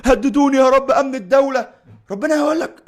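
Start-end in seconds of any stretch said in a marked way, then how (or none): 0.0-1.7 mock whiny plea. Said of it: "يعني هتقول له".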